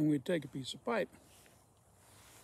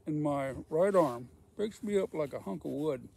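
usual speaking voice

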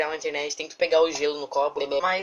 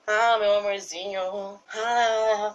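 mock lovey-dovey; 4 semitones higher, much slower than usual. Mock romantic dancing quote.

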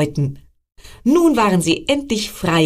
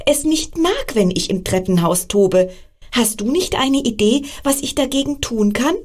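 high, bright squirrel voice; excited squirrel; 6 semitones higher than usual. Acted. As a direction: chirp it out like an eager little squirrel. Squirrel Emil talks to frog.

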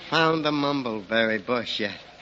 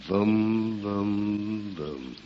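voom dying away, much softer each time; fading echo; 4 semitones lower, 7 dB quieter, much slower than usual. Pretend. Rip mimics the barrel echo, softer and softer. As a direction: let the echo fade to nothing. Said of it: "shout down a rain barrel".

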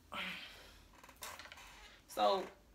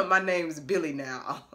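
slow, lower mock impression of a rapper; 7 semitones lower, slower than usual. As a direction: drawl it slow and deadpan. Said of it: "he was like".

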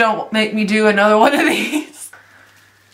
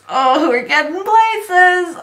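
excited; 7 semitones higher, slower than usual. Real, not acted. Excited at progress.

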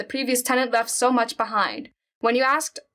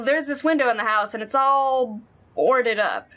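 ordinary talk

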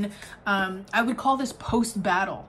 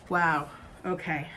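ordinary talk, nothing marked

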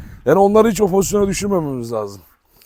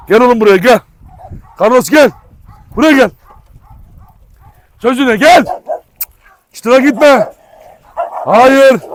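loud, high shouted commands; shouting a dog off a fight; yelling at dog; 6 semitones higher, 7 dB louder than usual. Real, not acted. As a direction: shout the dog back to you.

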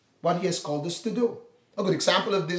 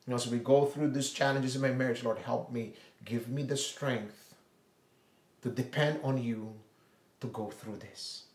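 quiet prayer; 6 semitones lower, 10 dB quieter than usual. Pretend. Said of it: "you look at your marriage".

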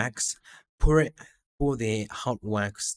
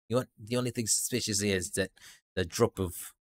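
ordinary talk, nothing marked

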